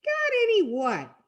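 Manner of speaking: high, incredulous comeback; high, put-on character retort; high-pitched retort. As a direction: throw it back high and indignant. Acted quote, challenged character's retort.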